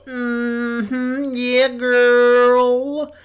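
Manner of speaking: high, drawn-out mock cheering; exaggerated high-pitched agreement, held long; obnoxious girl imitation. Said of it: "it was the most obnoxious thing".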